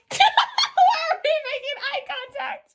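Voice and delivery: very high, loud laughing outburst; cracking up in a high squeal. Real, not acted. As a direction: squeal the question through real laughter. Cracks up at awkward eye contact.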